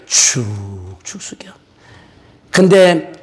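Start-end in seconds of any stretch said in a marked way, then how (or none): 0.1-1.5 whisper. Acted. Illustration of humble grain.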